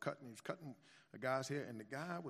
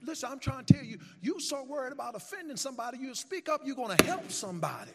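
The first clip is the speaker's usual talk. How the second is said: full-force preaching; 9 semitones higher, 8 dB louder than usual. Acted.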